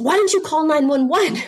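high, alarmed question; shocked older brother on the phone to his little sister; incredulous. Retold or imitated. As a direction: ask it in disbelief, voice jumping up high. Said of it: "I said".

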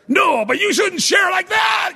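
very high-pitched mock shout; hammed-up, high indignant protest; imitated angry outcry. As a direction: yell it like an outraged objector. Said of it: "they'd get so angry at him".

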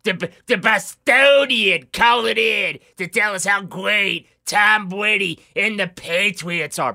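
hammed-up Boston accent; bad imitation of a Boston fan. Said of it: "worst Boston accent imitation ever".

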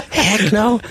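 high, laughing exclamation. Real, not acted. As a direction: say it through a laugh. Laughing at own word choice.

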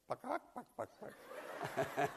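high, quick imitated clucks; hen clucking. Telling the bus story, clucks like the hidden hen.

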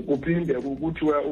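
ordinary delivery

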